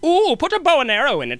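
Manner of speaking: high, loud excited producer; old-timey producer voice. Retold or imitated. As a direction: gush like a movie mogul. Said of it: "Old-timey voice".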